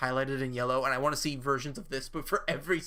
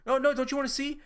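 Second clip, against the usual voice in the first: mock eager; 9 semitones higher, 3 dB louder than usual. Mocking chat's meme request.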